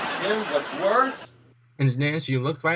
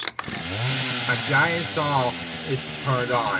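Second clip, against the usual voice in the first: ordinary delivery, nothing marked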